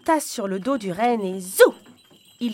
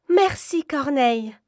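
high, louder cry full of hope; exclaimed hopefully; 6 semitones higher, 5 dB louder than usual. Acted. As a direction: thank the crow with sudden hope. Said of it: "s'exclama Gerda, pleine d'espoir".

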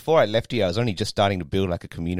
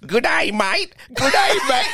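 much higher, louder hammed-up Aussie accent; mock Aussie accent; 6 dB louder, slower than usual. Drunk lady tries Aussie greeting on him, hammed-up Aussie accent. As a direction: parrot a tourist's Aussie accent, way up high. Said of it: "and then she's like".

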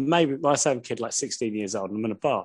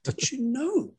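higher, airy mock brag; mock boastful self; 11 semitones higher, 5 dB quieter than usual. Husband mocking his own bragging to his wife, mock brag. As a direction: send up your own proud claim.

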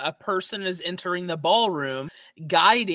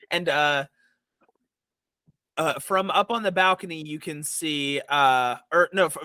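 ordinary talk

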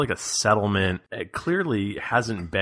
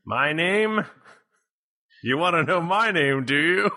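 hammy stage actor; 9 semitones higher than usual. Acted. GM voices village elder.

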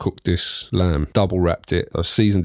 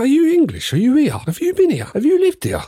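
mock disbelief, much higher and louder; incredulous high squeal; 4 dB louder than usual. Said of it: "I was like".

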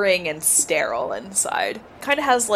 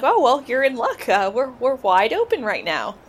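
perky receptionist; 6 semitones higher, 4 dB louder than usual. Acted. GM voices the receptionist.

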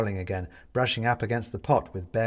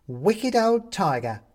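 high, slow scolding shout; old woman shouting over the sill; 10 semitones higher, 5 dB louder, slower than usual. Acted. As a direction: scold the fallen cat. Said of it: "shouts the woman".

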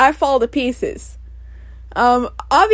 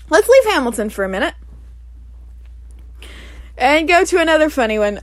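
ordinary talk

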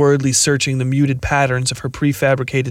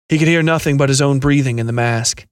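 ordinary delivery

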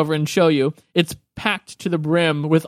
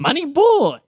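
put-on exuberant old gnome woman; 10 semitones higher than usual. Old gnome woman greeting returning adventurers, exuberant and high.

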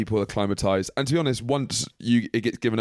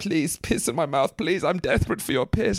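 desperate goblin plea; 6 semitones higher than usual. Acted. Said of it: "He's like".

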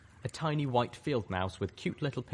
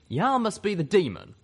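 higher, louder folksy character voice; drawling sidekick; 10 semitones higher, 6 dB louder than usual. A narrator's reading. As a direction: call it out to the monster. Startled sidekick confronts monster.

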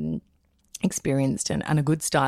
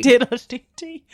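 slow, higher Irish diddle-dee-dee; mock Irish; 6 semitones higher, 4 dB louder, slower than usual. Guest echoing the host in mock Irish. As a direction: echo it in a cartoon Irish lilt. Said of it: "Did you say fiddle-dee-dee?".

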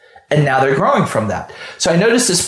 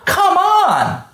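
high, scoffing come on; exasperated; 13 semitones higher than usual. Real, not acted. Scoffing at the worker who didn't know why. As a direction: throw up your hands at the excuse.

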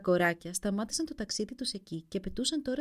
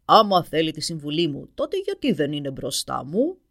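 ogre roar; 3 semitones lower, 10 dB louder than usual. A narrator's reading. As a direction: roar like a hungry dragon. Dragon answers mother. (βροντερή φωνή δράκου που βρυχάται)

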